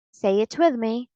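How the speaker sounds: usual speaking voice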